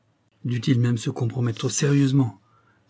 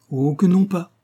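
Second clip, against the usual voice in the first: high, louder, jeering refusal; odious familiarity; 7 semitones higher, 5 dB louder than usual. A narrator's reading. Doman dodges her grab for the letter, odiously familiar. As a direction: taunt them with insolent over-familiarity. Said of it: "avec un accent d'odieuse familiarité".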